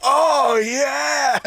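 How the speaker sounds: high, loud ecstatic shout; mock ecstatic shout. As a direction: shout it out in hammed-up bliss. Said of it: "he's just going".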